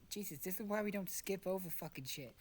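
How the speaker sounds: ordinary delivery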